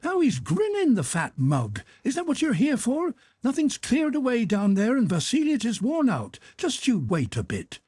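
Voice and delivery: put-on high, sharp old-woman scolding; scolding housekeeper. A narrator's reading. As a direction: tear into the lazy porter. Housekeeper scolds the grinning porter.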